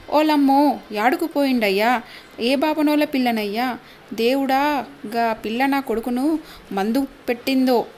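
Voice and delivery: ordinary delivery, nothing marked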